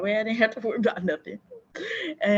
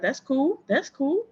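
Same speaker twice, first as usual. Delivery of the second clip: breezy unbothered; 7 semitones higher, 5 dB louder, slower than usual. Retold or imitated. Voicing her own carefree attitude.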